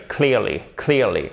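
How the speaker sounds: "clearly" as KLEER-lee, falling; correct English pronunciation. Shown as a demonstration. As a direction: repeat "clearly" with EE and falling end. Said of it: "c'est clearly". (« clearly » à l'anglaise, KLIIR-li)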